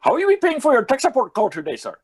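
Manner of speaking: high, rapid mock Sri Lankan voice; mock Sri Lankan accent. Call-center agent asking a customer, mock Sri Lankan accent. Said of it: "eastern lower tip of Sri Lanka".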